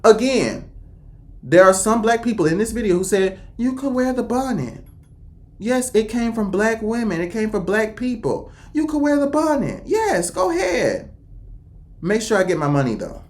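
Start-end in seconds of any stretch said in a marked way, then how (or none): 3.6-11.1 high, softer quoted voice; imitating easygoing approval, pitched up; mock permissive. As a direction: grant permission in a high, light lilt. Quoting people giving permission.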